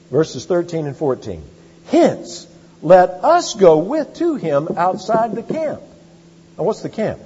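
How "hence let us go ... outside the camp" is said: higher, louder and slow, each word weighed; emphatic scripture reading. Acted. Preacher reading the verse to the congregation, slow and emphatic. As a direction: read the verse out slowly and with weight.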